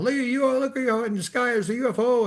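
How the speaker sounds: high-pitched imitation of excited yelling; mock screaming. Imitating the family spotting a UFO, mock screaming. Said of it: "everybody starts screaming and yelling".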